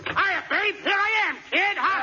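put-on high, excited hail to a girlfriend; brash, high-pitched sailor greeting. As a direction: burst in hollering. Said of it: "I'm a sailor".

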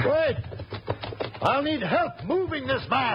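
high urgent call. Acted. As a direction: shout after them to wait. Calling after departing men.

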